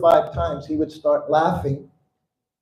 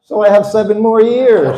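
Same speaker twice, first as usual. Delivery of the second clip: laughing through it; 5 semitones higher, 7 dB louder than usual. Real, not acted. Laughing at his own joke.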